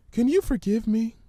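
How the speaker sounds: high, hesitant frightened pony; Fluttershy's voice. Acted. Fluttershy fearfully reciting the ominous words, timid and high.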